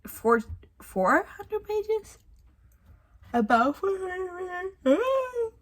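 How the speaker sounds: high, slow, whiny pleading on "four hundred pages"; exasperated disbelief. Real, not acted. Frustrated reader moaning about a book's length, high and whiny. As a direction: drag it out like it's unbearable.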